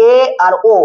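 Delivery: usual speaking voice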